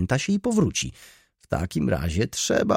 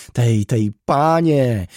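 loud, raised, vehement cry; bursting out; 5 semitones higher, 9 dB louder, much slower than usual. A narrator's reading. Baroness erupting about the hated tenant, bursting out. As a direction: burst out with renewed force. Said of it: "wybuchnęła z nową siłą głosu".

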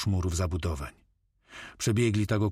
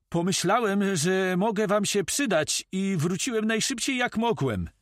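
high-pitched, eager character voice; loyal helper Moko; 11 semitones higher than usual. A narrator's reading.

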